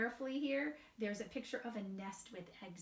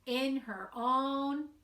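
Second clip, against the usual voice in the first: slow, sing-song storybook reading; gentle storybook narration with light character voices; reading to children; 2 semitones higher than usual. A narrator's reading. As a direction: read it aloud to a group of little kids. Reads picture book to children.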